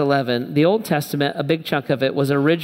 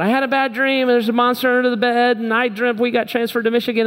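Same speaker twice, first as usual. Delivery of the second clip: mock scared kid; 6 semitones higher, faster than usual. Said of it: "all freaked out".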